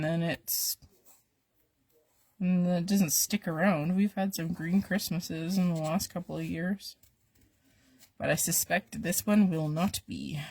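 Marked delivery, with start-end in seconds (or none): none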